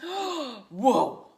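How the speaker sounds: loud gasp then whoa; surprised gasp. Acted. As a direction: act startled by the shark. Shark page revealed.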